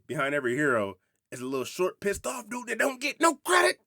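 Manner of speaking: high, loud comic impression rising to a squawk; hammed-up comedian's voice, pushed way up at the end; Eddie Griffin. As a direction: build to an indignant high-pitched punchline. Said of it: "it's Eddie Griffin being interviewed in prison".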